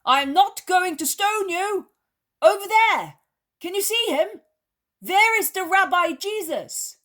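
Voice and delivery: high, loud and urgent; calling out. A narrator's reading. Peter calling to the leper about the Rabbi, loud and high. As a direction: shout it across the slope to her.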